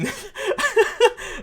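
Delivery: high helpless giggle; giggling. Real, not acted. Host mixing up nudes and news, high giggle. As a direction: crack up at your own slip. Laughs at own slip.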